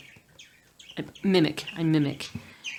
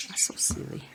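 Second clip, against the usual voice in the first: whisper; 5 dB quieter than usual. Real, not acted.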